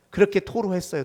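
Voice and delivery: ordinary talk, nothing marked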